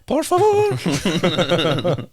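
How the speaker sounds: put-on slow, drawn-out Spanish-accented laughing plea; mock Spanish. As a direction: stretch it out in a hammed-up Spanish accent.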